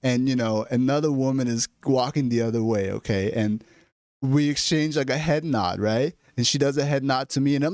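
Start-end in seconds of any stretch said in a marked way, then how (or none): none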